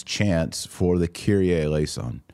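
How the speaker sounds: usual speaking voice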